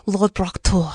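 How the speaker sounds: strangled French accent attempt. Shown as a demonstration. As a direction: say it like choking on chicken. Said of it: "it still sounds like you're choking on chicken".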